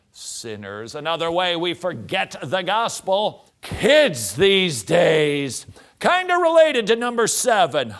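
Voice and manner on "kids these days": slow, loud, dragged-out grumble; grumbling old-timer. Acted. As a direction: drawl it like a fed-up elder. Preacher mocks grumbling adults.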